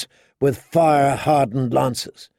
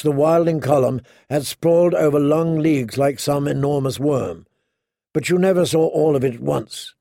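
ordinary delivery

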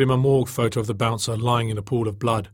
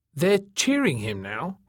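higher, slow and sharp; 5 semitones higher, slower than usual. A narrator's reading. Man correcting others about the cheering crowd, sharp. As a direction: stretch it out sharply, pitched up. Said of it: "he said sharply".

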